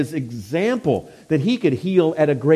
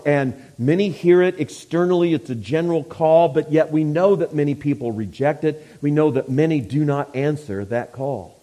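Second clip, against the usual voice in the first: ordinary talk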